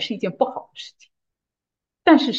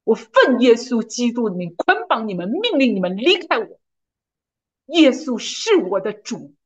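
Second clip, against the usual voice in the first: emphatic, measured prayer of command; commanding declaration; 3 semitones higher than usual. Retold or imitated. Commanding spirits to leave her, emphatic declaration. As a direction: proclaim it with firm authority. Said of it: "然后我就宣告".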